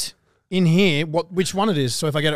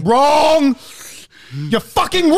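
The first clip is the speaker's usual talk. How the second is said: shouting; 9 semitones higher, 3 dB louder, much slower than usual. Acted. Mock game-show verdict.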